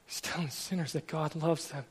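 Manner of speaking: preacher repeating the wife's words to the congregation, hushed; quiet reflective repeat. Real, not acted. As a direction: say it again softly, to yourself.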